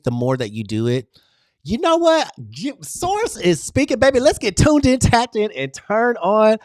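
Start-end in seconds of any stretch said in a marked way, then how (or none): 1.7-6.6 much higher and louder, a rousing call; fired-up channeling. Real, not acted. Channeling Source for her livestream audience, high and rousing. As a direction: announce it like spirit has taken over.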